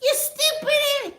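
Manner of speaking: very high, slow squeal; mock high-pitched woman; shrill lady. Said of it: "she's like".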